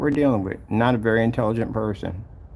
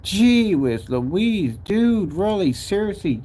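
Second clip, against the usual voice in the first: very slow, drawn-out "Gee whiz Louise"; drawn-out exasperation; exasperated; 7 semitones higher, slower than usual. Real, not acted. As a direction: sigh it out in disbelief. Exasperated at the customer.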